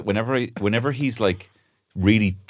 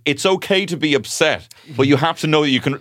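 gentle parenting voice; 8 semitones higher, 4 dB louder than usual. Retold or imitated. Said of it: "Or I have to say".

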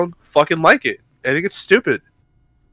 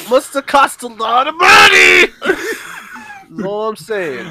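laughing; 6 semitones higher, much slower than usual. Real, not acted. Defensive, laughing through argument.

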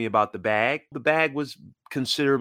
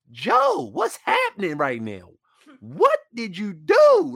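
much higher, pleading outcry; can't believe his friend did this; traded player confronting the teammate turned GM, hurt and disbelieving; betrayed disbelief; 10 semitones higher, 4 dB louder than usual. Retold or imitated. Confronting his former teammate Joe.

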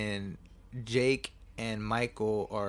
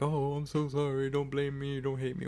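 higher-pitched mock apology; mock remorseful; 4 semitones higher than usual. Secretary apologizing to the grieving mother, mock remorseful. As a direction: plead with exaggerated remorse. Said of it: "like, remorseful".

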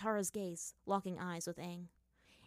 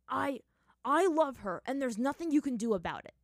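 louder, firm declaration; resolute; 6 semitones higher, 6 dB louder than usual. Acted. Zuko declares his love to Aang, firm and resolute. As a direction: stand your ground. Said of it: "the firebender said resolutely".

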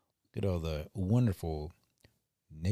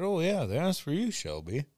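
teasing; 10 semitones higher, 3 dB louder than usual. Retold or imitated. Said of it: "I said".

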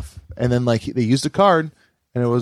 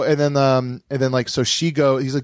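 ordinary delivery

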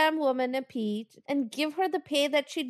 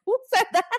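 mock puzzled; 9 semitones higher, 4 dB louder, faster than usual. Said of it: "I was like".

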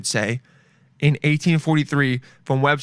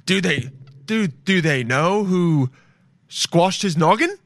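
slow, higher mock-scandalized gasp; prim old-timey shock; 5 semitones higher, slower than usual. Murderer feigning shock to a neighbour, slow hammed-up prim gasp. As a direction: ham up the shocked townsperson. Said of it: "But you'd be like".

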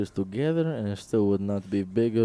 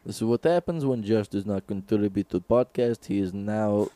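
deadpan mock Russian accent; 2 semitones lower, 4 dB quieter than usual. Russian guys arguing over the bit, in a Russian accent. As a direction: keep up a straight-faced Russian bit. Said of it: "completely lost with the Russian accent".

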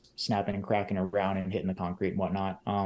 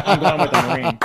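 laughing mock quote; 7 semitones higher, 11 dB louder than usual. Jokes over wife's line amid laughter.

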